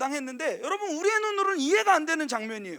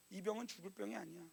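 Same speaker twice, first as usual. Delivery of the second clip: much softer, lower, calm; tender Jesus; 8 semitones lower, 19 dB quieter than usual. Retold or imitated. Jesus comforts the grieving sisters, much softer. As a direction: say it like a gentle promise.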